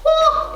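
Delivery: very high, loud woo; high whoop. Real, not acted. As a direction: whoop out high as you hop. Whoops during hop.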